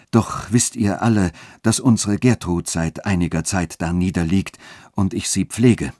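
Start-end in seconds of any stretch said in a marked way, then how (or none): none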